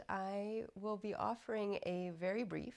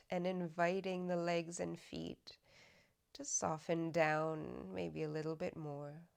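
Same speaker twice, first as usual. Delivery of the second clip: guided meditation; 4 dB louder than usual. Acted. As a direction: guide them unhurriedly into softening, evenly and calmly. Leads a body scan meditation.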